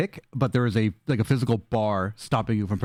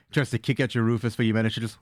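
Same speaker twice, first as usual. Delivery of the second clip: ordinary delivery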